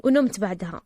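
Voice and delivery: plain reading voice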